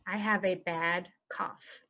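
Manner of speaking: ordinary delivery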